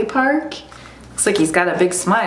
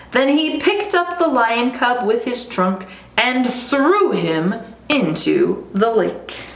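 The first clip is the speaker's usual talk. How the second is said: ordinary delivery, nothing marked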